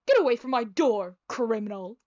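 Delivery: villain voice, loud at the start; evil-sounding doctor. Acted. Evil doctor orders an intruder off his door, villain voice. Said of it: "my typical evil sounding voice".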